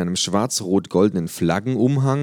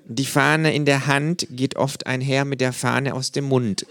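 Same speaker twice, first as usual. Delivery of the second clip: slow, lightly voiced senior physician; like a head doctor; 8 semitones higher than usual. A narrator's reading. Kangaroo to narrator, slow, like a head physician. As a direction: pronounce it like a diagnosis, slow. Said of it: "in einem Tonfall, als wäre es Chefarzt".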